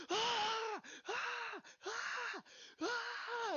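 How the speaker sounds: short, very high screams; repeated high shrieks, mimicking a panicked friend; imitated screaming. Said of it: "he just starts screaming".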